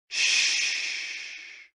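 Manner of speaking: drawn-out voiceless shh, fading away; long breathed shh. Shown as a demonstration. Narrator reads the poem's breathing cue for spring, long breathed shh. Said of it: "pronounced like shh".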